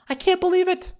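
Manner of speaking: "believe" squealed very high; mock outrage squeal. Vlogger scandalized by the cheating husband: high mock squeal. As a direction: squeak the line in hammed-up disbelief. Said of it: "mock outrage repeat".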